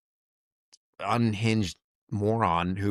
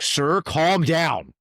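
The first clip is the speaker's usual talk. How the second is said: raised pitch, drawn-out firm order; flight attendant's order; 7 semitones higher, 4 dB louder, much slower than usual. Retold or imitated. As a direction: imitate an attendant slowly insisting. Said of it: "the flight attendant is like".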